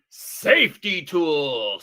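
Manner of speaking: slow, low reveal announcement; slow dramatic reveal. Acted. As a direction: draw it out like a big reveal. Wheel result announced.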